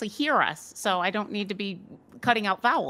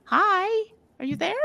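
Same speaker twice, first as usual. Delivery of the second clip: much higher, sing-song hello into the mirror; bright greeting; 11 semitones higher, 3 dB louder than usual. Acted. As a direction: call sweetly into the glass. Player greets ghost in mirror.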